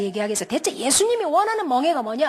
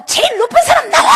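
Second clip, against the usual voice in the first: high, loud demand for the boss; angry yelling; 11 semitones higher, 7 dB louder than usual. Retold or imitated. Angry parent storming into the hospital office, high loud yell. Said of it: "막 소리를 치면서 갔어요".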